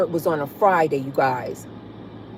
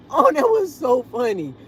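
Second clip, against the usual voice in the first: still laughing; 6 semitones higher than usual. Real, not acted.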